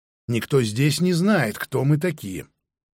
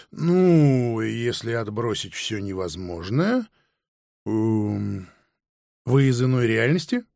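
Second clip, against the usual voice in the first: drawn-out, halting, with fillers; tentative, pausing, rising at the end; hesitant question; 2 semitones lower, slower than usual. A narrator's reading. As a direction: feel your way slowly to the question. Puzzled man feels out question.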